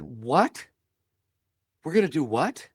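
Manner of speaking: high, rising double take on "We're gonna do what?"; disbelieving What. Retold or imitated. Young self hears of Alaska trip.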